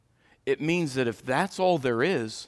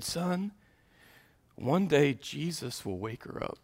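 tearful; 2 semitones lower, 7 dB quieter than usual. Retold or imitated. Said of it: "he said with tears".

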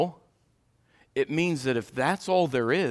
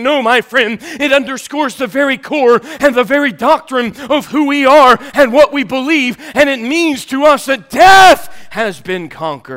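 loud, high, ringing preacher's call; full-force preaching; 8 semitones higher, 14 dB louder than usual. Acted. Preacher crying out to the congregation, loud and ringing.

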